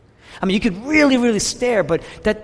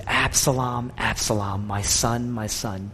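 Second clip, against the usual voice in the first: grieving lament; 6 semitones lower, 4 dB quieter, slower than usual. Retold or imitated. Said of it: "intense emotional expression".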